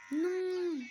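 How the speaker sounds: long, high, loud "no"; dismayed yell. Real, not acted. Gamer losing the moment mid-fight, dismayed yell. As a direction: cry out in drawn-out dismay.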